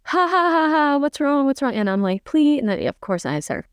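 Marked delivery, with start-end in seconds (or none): 0.0-1.7 high, imitated cackling jeer; mock them with a put-on ha-ha; taunting laughter. Man laughing after switching lights off, taunting. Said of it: "he starts laughing and he's like". 2.2-2.6 high, retold begging; cut-off high imitation of begging; desperate plea. Woman begging to be let go, desperate.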